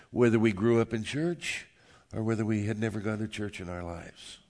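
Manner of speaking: normal talking voice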